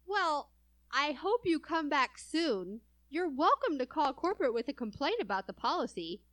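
overly sweet customer service; sugary polite brush-off; sarcastic. Retold or imitated. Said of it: "And I say".

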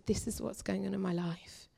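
hushed, near-whispered admission; retold tearful confession. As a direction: softly re-enact confiding through tears. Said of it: "I just burst out into tears".

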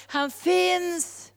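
high, ringing proclamation; calling out. Acted. All preaching and song proclaim God, calling out. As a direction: send it out as a call. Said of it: "så ropar all förkunnelse".

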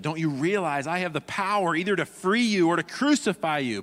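desperate Pilate. Retold or imitated. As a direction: plead with a man who won't answer. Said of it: "Pilate's desperate here".

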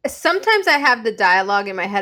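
ordinary delivery

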